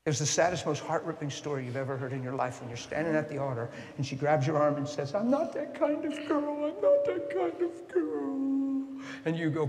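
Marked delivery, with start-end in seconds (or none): 5.1-9.0 high, slow tearful pleading in a woman's voice; crying plea. Retold or imitated. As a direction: beg through tears, stretching each word. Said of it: "she's crying it over and over".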